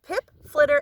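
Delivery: ordinary delivery, nothing marked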